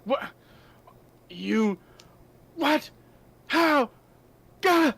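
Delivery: builds from puzzled to a high, loud roar; Minotaur's rising shock turns into rage; shocked to furious Minotaur. Acted. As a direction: stammer in disbelief, then explode. Minotaur realises the game is lost.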